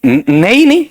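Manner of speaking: normal talking voice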